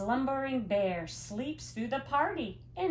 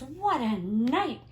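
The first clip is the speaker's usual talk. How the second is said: twittering wren; 5 semitones higher, 4 dB louder than usual. Acted. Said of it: "twitters wren".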